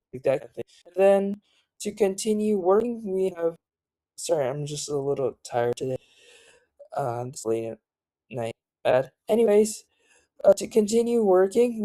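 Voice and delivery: ordinary delivery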